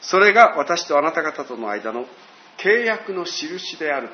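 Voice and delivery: lightly voiced solemn reading of scripture. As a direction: read God's words with weight. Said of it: "十一節に".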